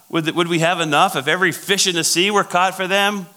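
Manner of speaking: high, loud mock disbelief; incredulous Moses. Moses laughing at God about fish in the sea, incredulous. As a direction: push the absurd question upward, scoffing. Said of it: "Moses is laughing at God".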